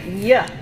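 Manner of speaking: Y said as a short y sound. Shown as a demonstration. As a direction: give the bare y sound. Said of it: "So everybody try it".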